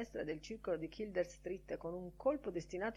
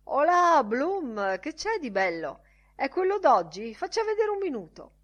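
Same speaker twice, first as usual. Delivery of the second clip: high, loud, pushy greeting voice; brash loud Bantam Lyons; 8 semitones higher, 11 dB louder than usual. Acted. Bantam Lyons hails Bloom for his newspaper, loud and high. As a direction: barge in loudly and grab the paper.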